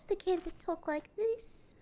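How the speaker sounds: put-on a little British child; British kid voice. Shown as a demonstration. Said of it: "heavy British".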